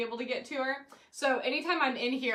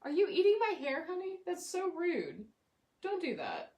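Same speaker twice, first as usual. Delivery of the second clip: softer, higher voice for the cat; gentle pet scolding; 6 semitones higher, 4 dB quieter than usual. Real, not acted. Host to cat chewing her hair, soft mock scold. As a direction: tell the cat off tenderly.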